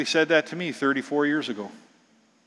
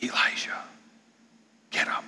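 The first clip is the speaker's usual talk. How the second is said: breathy unvoiced whisper; God whispering to Elijah; 10 dB quieter than usual. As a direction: whisper it right into his ear. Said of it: "The sound of a gentle whisper".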